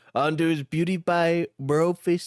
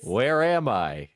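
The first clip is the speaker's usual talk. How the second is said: lower, slower, dazed and lost; dazed character voice; 7 semitones lower, slower than usual. Acted. Reading his own line in character, lower and dazed. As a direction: voice the dazed prisoner waking up. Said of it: "surely you can read your own dialogue in your voice".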